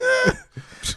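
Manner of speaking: short high chuckle of disbelief. Real, not acted. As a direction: laugh it off, amused. Chuckling at the small-party plan.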